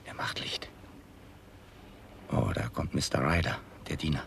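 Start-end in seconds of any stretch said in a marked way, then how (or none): 0.1-0.7 hushed warning; whisper. Acted. Nutting sending Dickens home, whispered. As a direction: keep it barely audible. Hiding, watching the house.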